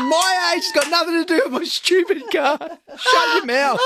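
hammed-up high, indignant outburst; fighting back. Dad retells snapping at son who called him old, mock-outraged. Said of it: "I fight back".

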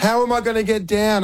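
put-on high, whiny little-girl voice; a dad imitates his stuck daughter asking how to get down; imitating scared daughter. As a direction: plead like a frightened child. Dad voicing frozen daughter.

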